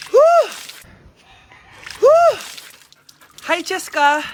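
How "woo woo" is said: high, loud joyful whoop; excited whoop. Acted. Woman whooping happily after her shower. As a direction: whoop with delight.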